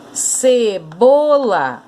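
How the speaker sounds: "cebola" very slow, in separate syllables. Shown as a demonstration. Teacher separating "cebola" for pupils, slow and syllabified. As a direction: stretch "cebola" into three slow beats. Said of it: "você vai separar a sílaba aqui".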